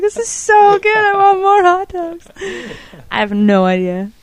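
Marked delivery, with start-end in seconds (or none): 0.0-2.3 high, slow and giddy, imitating her own delight; giddy excitement. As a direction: squeal it out like a kid given a treat. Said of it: "I was like".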